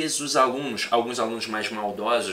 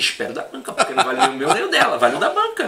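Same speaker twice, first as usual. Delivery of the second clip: chuckling through the words, a bit louder; laughing; 7 semitones higher, 4 dB louder, faster than usual. Real, not acted. Agreeing with the co-host about the exam board, laughing.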